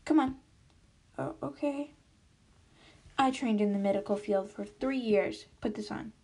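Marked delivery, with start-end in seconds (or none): none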